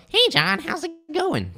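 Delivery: very high, squeaky hello; hammed-up falsetto sales greeting; exaggerated greeting. Shown as a demonstration. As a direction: overdo it in a comically high voice. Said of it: "I'm kinda exaggerating it".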